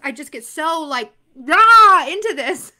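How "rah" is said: a loud excited roar of enthusiasm; excited rah. Real, not acted. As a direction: let out a burst of real excitement. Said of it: "I'm getting out of breath here 'cause I could just".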